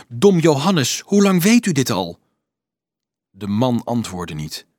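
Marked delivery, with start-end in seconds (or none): 0.1-2.2 louder, raised demand for an answer; mildly indignant, higher voice; accusing. A narrator's reading. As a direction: confront him sharply. Dolf accuses monk of knowing.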